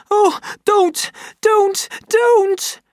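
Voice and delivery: high, loud, panicked pleading scream; terrified scream. Acted. Linda screams at the women beating her, terrified. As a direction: shriek it while being whipped. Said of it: "Then Linda screamed".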